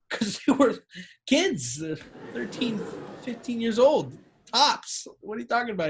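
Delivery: incredulous protest. Acted. Young employee to his boss about the underage girls, incredulous. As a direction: protest in disbelief, pitch lifted.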